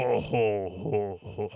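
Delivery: mock Hutt laugh, trailing off; imitating Jabba. Streamer explains Huttese means Jabba, light mock chuckle. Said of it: "you just think of Jabba the Hutt, so you're like".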